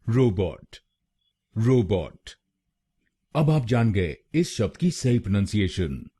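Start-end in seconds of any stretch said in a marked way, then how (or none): 0.1-2.3 robot with the ro pushed a touch harder; stress on RO. Shown as a demonstration. Teacher showing the stress on ro, robot with a firmer first syllable. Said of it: "आरओ पर जोर डालना है".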